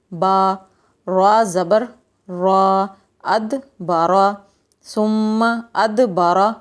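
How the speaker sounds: syllables joined slowly, يس آ stretched long; slow spelling out. Shown as a demonstration. Teacher joining syllables slowly for children. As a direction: build the word syllable by syllable. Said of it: "اب کیا sound نکلتا ہے".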